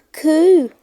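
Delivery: put-on a boy amazed on the platform; excited little boy. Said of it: "said one".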